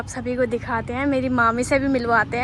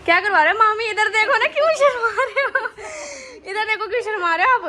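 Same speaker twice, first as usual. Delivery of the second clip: high, loud, giggly teasing; playful teasing; 8 semitones higher, 7 dB louder than usual. Real, not acted. Niece teasing her shy aunt at the parlour, high giggly teasing. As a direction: coax her to look at the camera, laughing.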